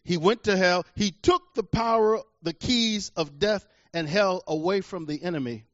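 normal talking voice

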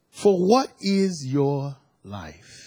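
drawn-out, weighty reading of the verse; slow emphatic question. Real, not acted. Pastor reading James to the congregation, slow and weighty. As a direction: let each word land slowly. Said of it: "slow scripture reading".

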